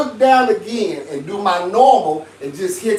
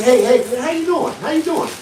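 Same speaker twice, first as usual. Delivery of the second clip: much higher, light-voiced hello; friendly high greeting; 7 semitones higher than usual. Shown as a demonstration. Showing listeners how tone differs, high and friendly. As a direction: lift it way up into a cheery greeting. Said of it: "You hear that? That's the difference".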